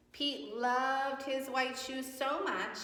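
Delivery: high, slow, drawn-out story voice; storytime reading. A narrator's reading. Teacher to class, reading Pete the Cat aloud. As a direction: read it like a picture book. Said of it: "story read to children".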